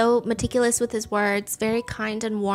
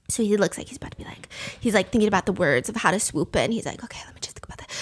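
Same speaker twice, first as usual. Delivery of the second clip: hushed, voiceless whisper; whispered aside; 3 semitones lower, 5 dB quieter, faster than usual. Retold or imitated. Imagining finance bro planning to swoop in, hushed whisper. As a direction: whisper it like plotting a pickup line. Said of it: "lower my voice".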